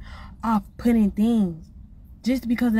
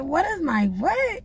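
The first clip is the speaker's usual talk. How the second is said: What is my what, slow and higher in disbelief; incredulous slow repeat, higher; retold disbelief; 6 semitones higher, slower than usual. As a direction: drag it out like you can't believe your ears. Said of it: "I said".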